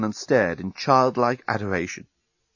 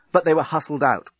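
usual speaking voice

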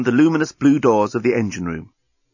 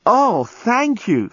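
Lucy's voice; 11 semitones higher, much slower than usual. A narrator's reading. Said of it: "said Lucy".

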